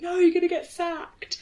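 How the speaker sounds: high, urgent hold-back cry; alarmed warning. Retold or imitated. Girlfriend grabbing her partner, alarmed urgent warning. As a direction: pull her back before it's too late.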